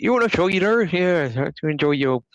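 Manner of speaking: exaggerated high Bristolian impression; Bristolian accent. Shown as a demonstration. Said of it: "Bristol's like".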